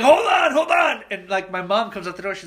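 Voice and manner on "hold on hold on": high, loud frantic shout; dad's shout. Retold or imitated. Dad to his son while driving, frantic shout. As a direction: shout it over the panic. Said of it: "He's like".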